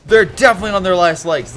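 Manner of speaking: high, loud smug boast; mock cocky. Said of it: "'cause it's like".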